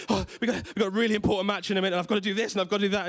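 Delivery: retelling his own frantic excuses to his wife; flustered explanation. Retold or imitated. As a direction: gabble the excuses like a man in a hurry. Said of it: "And I'm like".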